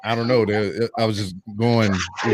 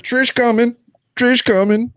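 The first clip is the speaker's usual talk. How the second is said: mock hype voice; 12 semitones higher, 4 dB louder, slower than usual. Mocking rumor sites.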